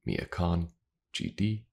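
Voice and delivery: ordinary talk, nothing marked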